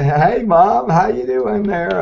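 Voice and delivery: high, bright folksy drawl of a president greeting Mom; Clinton impression. Voice actor closing the podcast, greeting Mom as Clinton. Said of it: "or we could do Clinton".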